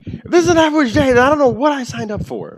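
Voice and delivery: a nervous townsman pushed up high and loud; high npc voice. Acted.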